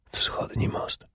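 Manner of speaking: breathy secret whisper. A narrator's reading. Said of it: "szepnęła teraz". (szept w tajemnicy)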